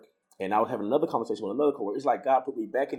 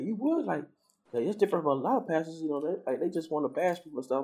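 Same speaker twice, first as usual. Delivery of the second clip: lightly voiced coworker, pitched higher; imitated coworker; 7 semitones higher than usual. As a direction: mimic a coworker's reaction in a higher voice. Acting out coworker's surprised reaction.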